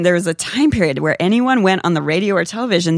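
usual speaking voice

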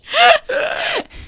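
high, shrieking laugh; real high-pitched cackle; loud laugh. Real, not acted. Laughing at own line.

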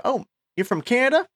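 high, drawling Texan client; Texas accent. Retold or imitated. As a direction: Texas accent, laughing through it. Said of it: "I'm gonna do my Texas accent".

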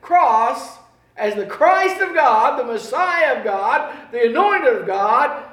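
loud, high preaching at full force. Acted. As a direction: proclaim it from the pulpit.

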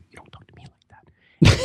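imitated confiding whisper, very hushed; articulate whisper. Imitating a woman overheard two rows away in the library, articulate whisper. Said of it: "articulate voice going".

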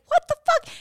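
high-pitched mock disbelief; retold shock. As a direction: squeak it out, re-enacting the shock. Retelling reaction on opening the gift.

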